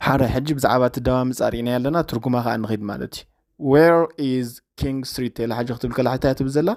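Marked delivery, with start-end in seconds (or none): 3.7-5.4 slow model reading. Shown as a demonstration. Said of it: "slow model reading".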